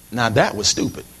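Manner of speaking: rueful laugh. Retold or imitated. Preacher recalls breaking his toy, softly laughing. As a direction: chuckle the line out, embarrassed. Realizing the foolish act.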